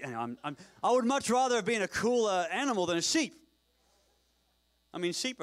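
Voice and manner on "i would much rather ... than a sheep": loud, high comic complaint; mock protest. Real, not acted. Preacher objects to being called a sheep, loud protest. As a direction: burst out protesting, pitched way up.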